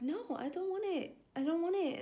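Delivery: mock high whine of refusal; high protest. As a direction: refuse like a cornered child. Arguing back with inner voice.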